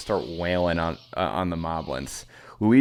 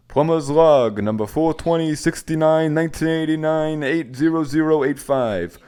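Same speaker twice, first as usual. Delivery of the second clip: hammed-up Italian plumber, louder; 5 semitones higher, 4 dB louder than usual. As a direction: read the log like a goofy plumber. Luigi voiced in script read.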